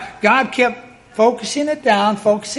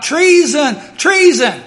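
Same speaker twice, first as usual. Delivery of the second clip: loud, higher cry of treason; a queen crying out in alarm; 6 semitones higher, 5 dB louder, slower than usual. Retold or imitated. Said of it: "Athaliah cries out".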